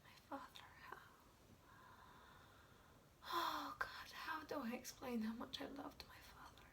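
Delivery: halting, reverent softness; quiet and prayerful, searching for words; hushed, pained confession. Acted. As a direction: confess it softly, with long pauses. Hushed confession of love for father.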